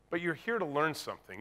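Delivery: normal talking voice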